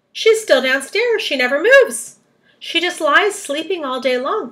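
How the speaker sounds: high, loud storybook voice; excited explanation. A narrator's reading. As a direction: explain the find eagerly. Grasshopper excitedly explains the solution.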